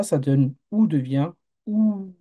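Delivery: "OU" short first, then the second "OU" held long; slow contrast of short and long "OU"; short then long vowel. Shown as a demonstration. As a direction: stretch the second "OU" to two beats. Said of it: "la lettre qui va prolonger".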